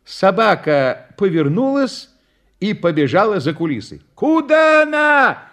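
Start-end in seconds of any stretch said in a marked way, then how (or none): 4.2-5.5 high, loud, drawn-out yell; clown's shout. A narrator's reading. Clown as his dog runs backstage, shouting. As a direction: call out in alarm, high and loud. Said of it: "закричал клоун".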